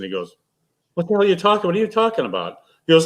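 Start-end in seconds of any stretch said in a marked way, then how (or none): 0.9-2.6 higher-pitched, baffled retort; retold incredulous challenge. Bowler quoting his friend's reply to the kid, incredulous. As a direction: imitate a friend's disbelieving comeback.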